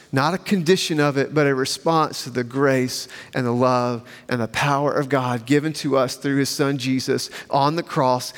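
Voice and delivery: ordinary delivery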